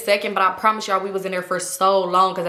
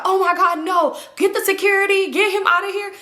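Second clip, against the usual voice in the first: put-on alarmed girls; 10 semitones higher than usual. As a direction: sound shocked and urgent. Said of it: "They was like".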